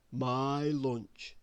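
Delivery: usual speaking voice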